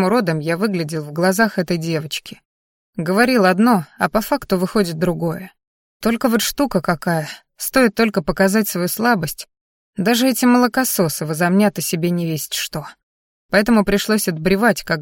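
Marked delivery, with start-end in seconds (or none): none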